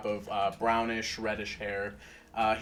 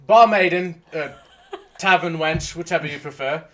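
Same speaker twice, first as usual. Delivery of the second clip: louder, higher call to the barmaid; loud sassy barmaid calls; 4 semitones higher, 9 dB louder than usual. Acted. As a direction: call her over cheekily, half-shouted. Calling out for the barmaid.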